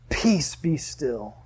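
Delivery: very slow, soft and settled; hushed, drawn-out command; calm command. Acted. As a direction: still the sea with quiet authority. Reading Jesus's command to the sea.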